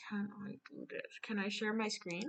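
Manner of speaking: ordinary talk, nothing marked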